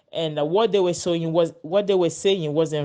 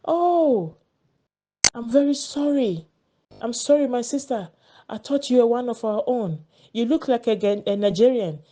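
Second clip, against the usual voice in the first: higher, retold apology to a fellow woman; apologetic man imitated; 5 semitones higher than usual. Man apologizing to the Ghanaian woman, higher and pleading. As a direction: play him suddenly sorry and high. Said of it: "he was like".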